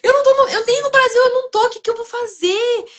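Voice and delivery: high, tearful pleading; retelling her own upset reply, voice breaking; crying protest. Retold or imitated. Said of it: "Eu falei".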